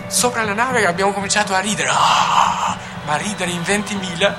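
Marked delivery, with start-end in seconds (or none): none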